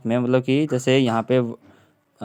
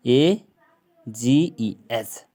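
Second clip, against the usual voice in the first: normal talking voice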